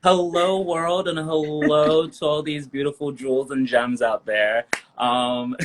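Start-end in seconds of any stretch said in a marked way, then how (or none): none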